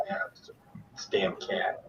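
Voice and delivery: whispered aside under breath; whispering. Real, not acted. Muttered aside about the cat.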